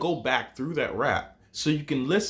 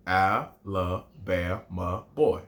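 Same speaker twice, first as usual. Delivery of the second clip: put-on "Alabama boy" even slower and low; slower, lower recitation; 6 semitones lower, 3 dB quieter, much slower than usual. Shown as a demonstration. Teacher repeating haiku line low and very slow. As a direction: say it again, deeper and slower. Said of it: "my haiku starts like this".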